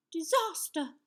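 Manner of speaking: put-on high, loud boyish wail; cried out in dismay. A narrator's reading. Young knight fails to find the underpants, crying out. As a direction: exclaim it high, aghast. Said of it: "cried Sir Scallywag".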